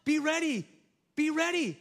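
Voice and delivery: high, loud, urgent call to wake; urgent wake-up shout. Retold or imitated. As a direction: shake them awake with your voice. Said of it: "It's like grabbing somebody and saying".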